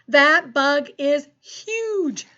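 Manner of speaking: excited exclamation. Shown as a demonstration. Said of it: "Say it with me".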